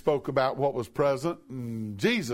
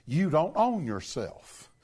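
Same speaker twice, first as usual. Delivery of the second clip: ordinary talk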